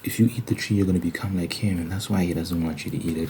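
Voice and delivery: ordinary delivery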